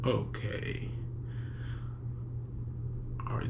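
ordinary talk

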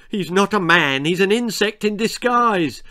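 put-on high, loud boyish outburst; alarmed realisation. Children deciding what the old man is, alarmed. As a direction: blurt out a dreadful discovery.

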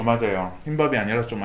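talking between bites; chewing while talking. Real, not acted. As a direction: mouth partly full of rice. Seen 3: Rice bite taken at -5; cheeks bulge, chewing while talking early.

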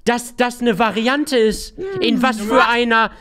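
loud, high furious rant; angry gamer rant. Real, not acted. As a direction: rage loudly at the game. Raging at the game, shouting. (wütender Gamer-Ausraster)

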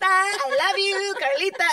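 high, slow, drawn-out I love you; put-on voice. Shown as a demonstration. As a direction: stretch it out in a lightly put-on voice. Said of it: "a voice like that".

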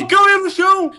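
high, loud yell rising to a scream; loud, high in-game scream; caught and shouting. Real, not acted. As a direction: shout it as you get found. Player gets found in hiding.

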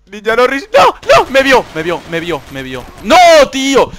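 high, loud shriek of dismay; dismayed scream. Real, not acted. Gamer caught by enemy, loud shriek. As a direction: yell as you get caught. Spotted and killed.

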